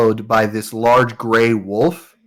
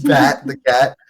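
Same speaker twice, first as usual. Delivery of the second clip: ordinary delivery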